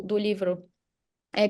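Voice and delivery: ordinary talk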